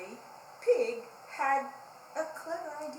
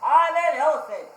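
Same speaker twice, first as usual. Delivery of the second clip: boasting; 13 dB louder than usual. Acted. Pig boasting to Kangaroo.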